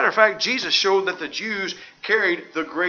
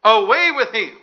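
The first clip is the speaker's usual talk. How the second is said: the repeat pushed harder and louder; the mob's second cry, at full force; 2 semitones higher, 9 dB louder than usual. A narrator's reading. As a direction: shout it again, stronger than the first. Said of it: "They cried out".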